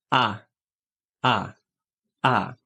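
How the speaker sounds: "A" as the short open o of "hot". Shown as a demonstration. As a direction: model the short vowel. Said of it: "O som curto de O". (o curto soando como "á")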